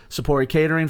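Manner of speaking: usual speaking voice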